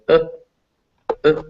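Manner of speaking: short, barely pronounced "uh"; schwa sound. Shown as a demonstration. Teacher showing students the schwa, barely pronounced "uh". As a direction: say "uh" as an unstressed schwa, barely there. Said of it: "It's a barely pronounced vowel".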